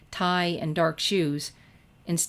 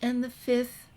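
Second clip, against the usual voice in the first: put-on higher, timid girl's voice; Fluttershy; 6 semitones higher, slower than usual. A narrator's reading. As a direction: ask gently, a little afraid to speak up. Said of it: "She ducked behind her curtain of hair".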